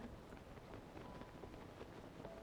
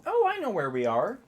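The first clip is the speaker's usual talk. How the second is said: excited; 8 semitones higher than usual. Real, not acted. Suddenly recognizing the place.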